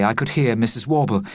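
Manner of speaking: ordinary delivery, nothing marked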